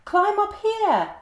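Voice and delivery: calling down from the treetops; monkey calling. A narrator's reading. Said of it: "called the monkey from the treetops".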